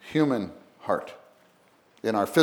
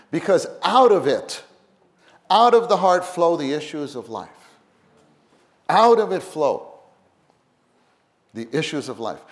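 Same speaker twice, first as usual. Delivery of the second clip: loud, higher preaching; 3 semitones higher, 6 dB louder than usual. Acted. Preacher to congregation, loud and high. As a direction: lift the voice and drive the point home.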